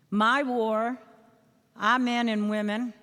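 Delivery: usual speaking voice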